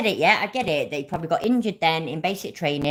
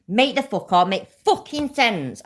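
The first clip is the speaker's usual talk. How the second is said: raised, exasperated outburst; angry; 4 semitones higher, 3 dB louder than usual. Real, not acted. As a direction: snap it out in real frustration, higher and louder. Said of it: "Sorry, I'm a bit angry today".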